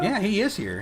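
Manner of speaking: usual speaking voice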